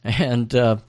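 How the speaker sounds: ordinary talk, nothing marked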